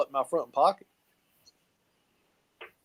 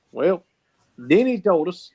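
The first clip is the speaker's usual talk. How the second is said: usual speaking voice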